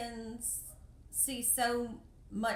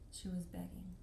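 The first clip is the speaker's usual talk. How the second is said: softer, sinking repeat; sad echo; 4 semitones lower, 6 dB quieter than usual. Real, not acted. Realizing the girl begged, soft sad repeat. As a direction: let the truth sink in quietly. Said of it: "I'm a little emotional".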